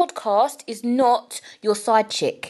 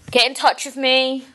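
ordinary talk, nothing marked

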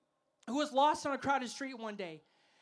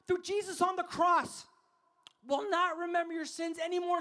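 raised, emphatic pulpit voice; impassioned preaching; 4 semitones higher, 5 dB louder than usual. Acted. Preacher urging the crowd to grasp forgiveness, impassioned preaching. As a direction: preach it out loud and high, driving each phrase.

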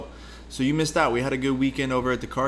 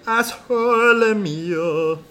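slow, high, loud cartoon voice; Ace Ventura voice; 11 semitones higher, 7 dB louder, much slower than usual. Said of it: "When he's like".

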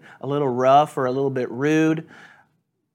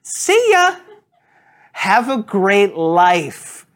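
high, slow mock-cheery goodbye; mock-sweet send-off while hitting the gas; sarcastic farewell; 4 semitones higher, 5 dB louder, much slower than usual. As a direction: drive off with a sarcastic wave. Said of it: "was like".

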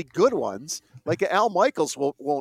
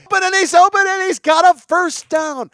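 much higher, louder overhyped announcer call; mock an announcer going crazy over a first down; Chris Fowler impression; 9 dB louder than usual. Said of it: "it's like".

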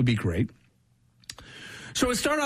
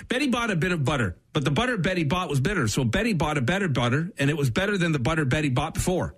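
quick, clean run at the tongue twister; fast tongue twister; 2 semitones lower, faster than usual. Shown as a demonstration. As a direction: rattle it off fast. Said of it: "best I've heard you phrase anything".

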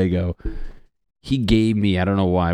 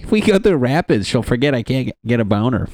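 much higher, fast, hammed-up commercial husband; excited kayak guy; 4 semitones higher, faster than usual. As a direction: play the overeager old guy in an ad. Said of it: "They're like".

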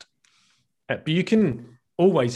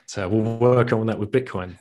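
ordinary talk, nothing marked

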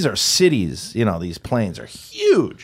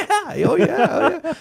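very high, silly affirming voice; blithe pilot; slower than usual. Acted. Pilot confirming bullets come through, comically blithe. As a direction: confirm it in a goofy falsetto.

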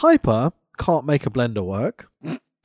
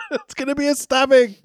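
mock alarm; 8 semitones higher than usual. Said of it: "And you're like".

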